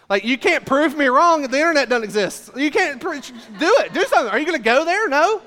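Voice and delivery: high, loud, laughing taunt like a fifth grader; smug kid daring friends; childish taunt. Retold or imitated.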